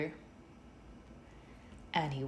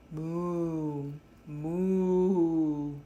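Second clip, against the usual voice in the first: cow moo; 4 dB louder than usual. A narrator's reading. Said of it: "The cow said".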